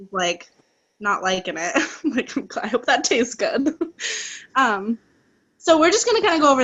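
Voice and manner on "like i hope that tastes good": amused, talking through a laugh. Real, not acted. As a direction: say it while giggling at the mishap. Laughing at someone licking body spray.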